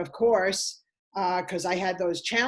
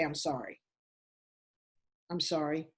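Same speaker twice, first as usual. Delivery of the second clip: much softer and lower, shrinking away; small apology; 5 semitones lower, 8 dB quieter than usual. Retold or imitated. A worn-down partner apologising softly for everything. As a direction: apologise meekly, almost swallowing it.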